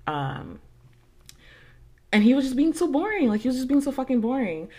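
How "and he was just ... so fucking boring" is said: loud, fed-up emphasis on boring; exasperated rant. Real, not acted. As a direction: vent it, fully annoyed. Venting about boring boyfriend.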